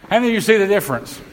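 rally a sluggish congregation for a response. Real, not acted. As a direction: ask it fast and high, prompting hands. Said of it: "I wish you all get as excited".

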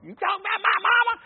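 hammed-up offended boy demanding an answer; 1 indignant boy. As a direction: puff up like a boy ready to fight. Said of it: "some little old boy".

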